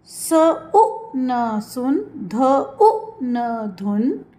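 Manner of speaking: very slow sounding out of letters; slow spelling out. Shown as a demonstration. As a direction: sound out each letter, then the word. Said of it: "short sound.".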